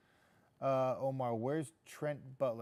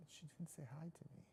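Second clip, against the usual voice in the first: very soft, higher-pitched grumble; mock sulk; 4 semitones higher, 17 dB quieter than usual. Host quietly nursing a pretend snub, mock hurt. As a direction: say it small, almost under the breath. Repeats the complaint under his breath.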